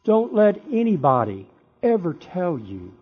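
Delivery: normal talking voice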